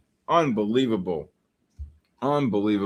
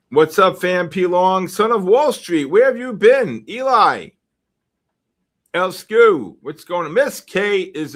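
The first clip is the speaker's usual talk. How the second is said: loud, high excited shout-out; calling out greetings; 6 semitones higher, 6 dB louder than usual. Real, not acted. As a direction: hail each arrival like long-lost family, loudly. Calls out greetings to arriving viewers.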